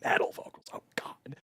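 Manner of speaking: barely audible, breath-only whisper; hushed, voiceless muttering. Real, not acted. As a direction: whisper it aside to yourself. Reacts under breath to reading.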